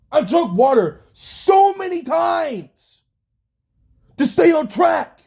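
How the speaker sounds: yelling out real frustration at the job; frustrated yelling. Real, not acted. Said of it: "angry and just yelling".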